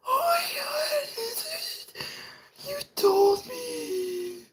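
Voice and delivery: very high shrieking falsetto with a gasp; hysterical lady. Retold or imitated. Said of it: "just speaking out of hysteria".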